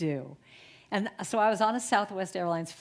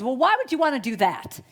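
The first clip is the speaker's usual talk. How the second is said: mock incredulous question, higher and louder; zaskoczona podejrzliwość; 5 semitones higher, 7 dB louder than usual. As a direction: imitate a baffled stranger challenging the offer. Woman baffled by offered coffee.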